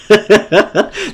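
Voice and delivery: high giggling laughter; delighted, high cackle; gleeful laugh. Real, not acted. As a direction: burst out laughing in triumph. Laughs at trapped opponent.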